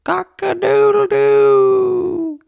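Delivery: long, high, slow cock-a-doodle-doo; rooster crowing. A narrator's reading. Rooster greeting the sun to wake the farmer, crowing. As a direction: crow it out like a rooster at sunrise. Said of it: "the rooster crowed".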